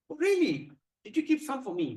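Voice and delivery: high-pitched, rising-then-falling interest; eager surprise. Acted. Parvati asking Shiva for some prasadam, eager surprise. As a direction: pounce on the news, wanting a share.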